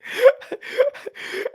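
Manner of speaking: high, wheezy burst of laughter; high-pitched cackling laugh. Real, not acted. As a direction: crack up helplessly. Cracks up at own talk.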